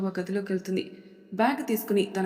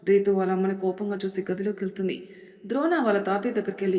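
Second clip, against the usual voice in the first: ordinary delivery, nothing marked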